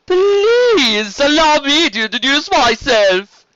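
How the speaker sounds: flamboyant serpent, loud, high and slow; Stefan. Acted. Sea serpent introducing himself to the dragon, flamboyant. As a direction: announce it grandly in a high, stretched voice. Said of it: "Stefan spoke up".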